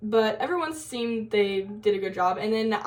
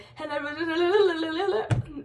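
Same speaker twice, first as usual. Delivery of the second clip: high-pitched gibberish rattled off; nervous fast babble; 7 semitones higher than usual. Retold or imitated. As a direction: babble imitating a rushed, nervous speech. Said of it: "I was also talking really fast".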